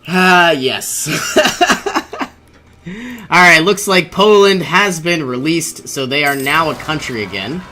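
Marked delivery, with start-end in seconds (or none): 1.1-2.3 high pleased laugh. Real, not acted. Pleased with alliance.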